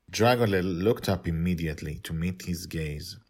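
usual speaking voice

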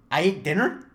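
high, loud startled question; surprised disbelief. Retold or imitated. Groggy guy asking his brother in shock. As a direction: act stunned to hear you ate dinner at all.

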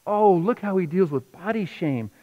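usual speaking voice

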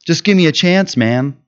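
mock a laid-back try-me-out; casual pitch. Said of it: "He's not like".

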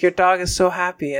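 mock gushing. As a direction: gush at the owner. Guy boasting to dog owner.

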